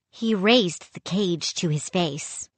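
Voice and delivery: ordinary delivery, nothing marked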